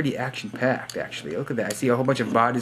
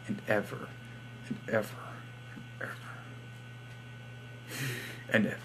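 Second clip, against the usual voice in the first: fading to a whisper; 15 dB quieter, much faster than usual. Pretend. Trailing repetition of forever.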